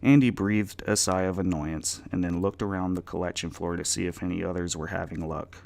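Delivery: ordinary delivery, nothing marked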